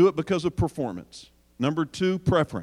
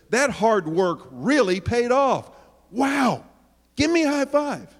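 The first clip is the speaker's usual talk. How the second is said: higher, gushing proud parent; gushing praise; 7 semitones higher, slower than usual. Acted. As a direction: cheer the kid on for the report card. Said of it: "How do you reward that report card?".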